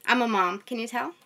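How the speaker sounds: normal talking voice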